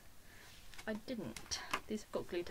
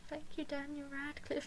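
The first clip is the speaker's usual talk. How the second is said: small, high, soft voice; starstruck shy thanks; 6 semitones higher, 6 dB quieter than usual. Retold or imitated. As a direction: imitate a meek, awed fan. Shy fan thanking her idol.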